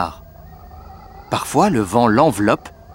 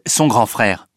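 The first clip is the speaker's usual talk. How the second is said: ordinary delivery, nothing marked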